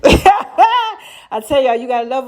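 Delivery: high, slow laughing through the words. Real, not acted. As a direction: let the laugh carry the line. Bursts out laughing mid-talk.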